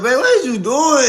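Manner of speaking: high, startled question; shocked. Retold or imitated. Catching her boyfriend at the mirror, high and startled. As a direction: catch him in the act.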